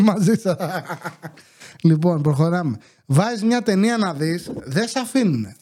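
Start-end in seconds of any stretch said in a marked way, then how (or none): 0.0-0.7 breaking into a laugh. Real, not acted. Comedian shares a risky story with the audience, laughing. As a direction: say it through a rising chuckle. Jokes about risk of sharing.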